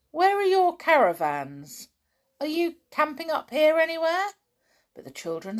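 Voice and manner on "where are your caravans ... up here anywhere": loud, higher falsely cheerful voice; shout, faking good temper; a cross man forcing himself to sound friendly; loud forced friendliness. A narrator's reading. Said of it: "He spoke to the children loudly".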